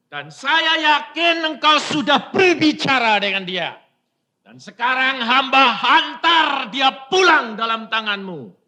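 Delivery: fervent prayer. Retold or imitated. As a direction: pray aloud with conviction. Retold prayer over daughter. (doa yang berapi-api)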